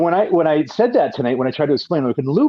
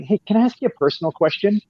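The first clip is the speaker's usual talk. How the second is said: lightly voiced prying question, pitched up; higher, lilting mock question; nosy coworker; 7 semitones higher than usual. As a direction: imitate a coworker angling for gossip. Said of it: "when they get to the line".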